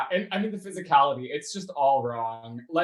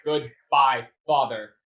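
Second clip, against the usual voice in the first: syllable by syllable; 3 semitones lower, 3 dB louder, slower than usual. Retold or imitated. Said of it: "breaking down multisyllabic words into singular syllables".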